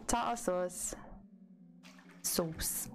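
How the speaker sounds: Tartar sauce said TAH-tah sauce, no r; British tartar sauce. Shown as a demonstration. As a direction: sauce with long aw. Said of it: "the way I say sauce".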